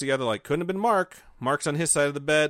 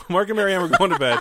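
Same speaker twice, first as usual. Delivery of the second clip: laughing retake; 5 semitones higher, 7 dB louder than usual. Shown as a demonstration. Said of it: "What is that supposed to mean?".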